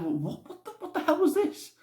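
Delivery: incredulous, rising in protest; baffled disbelief. Retold or imitated. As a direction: splutter it, then climb in bewilderment. Retelling reaction to bizarre ending.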